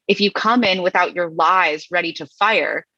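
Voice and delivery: ordinary talk, nothing marked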